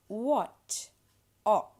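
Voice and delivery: "what" and "o" with an open aw vowel; open O sound. Shown as a demonstration. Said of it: "the open O sound".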